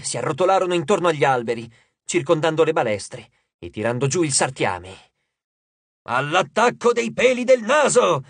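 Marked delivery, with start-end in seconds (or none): 6.0-8.2 higher, slow hammed-up charge yell; rally yourself into the attack; comic war cry; battle cry. A narrator's reading.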